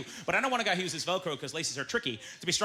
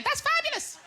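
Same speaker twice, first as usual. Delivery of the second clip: high squealed praise; gushing; slower than usual. Acted. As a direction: squeal it like a fan. Mock gushing over tall people.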